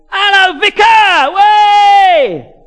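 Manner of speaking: put-on very loud, high Cockney street-kid shout; Cockney urchin yell. Cockney kid waving to the vicar over the mower, urchin yell. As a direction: holler like an Artful Dodger. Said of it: "Real Cockney urchin type".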